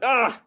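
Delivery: sudden high, loud dad yelp; shocked yell. Retold or imitated. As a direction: cry out in horror at the tattoo. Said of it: "I'm like".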